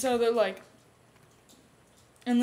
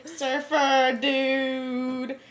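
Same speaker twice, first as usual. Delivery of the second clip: very drawn-out surfer drawl; mock surfer voice, long vowels; surfer dude; 3 semitones higher, 4 dB louder, much slower than usual. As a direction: stretch it out like a stoned surfer. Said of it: "Bubba decides he's a surfer".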